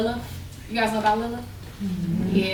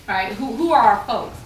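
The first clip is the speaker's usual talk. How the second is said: usual speaking voice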